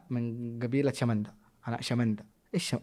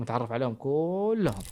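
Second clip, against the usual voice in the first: ordinary talk, nothing marked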